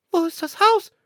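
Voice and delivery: very high, loud bird voice; sparrows asking. Acted. Sparrows search for their burnt house, high squeaky voice. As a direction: squeak the question like puzzled sparrows. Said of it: "fragten sie".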